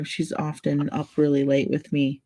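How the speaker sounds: ordinary delivery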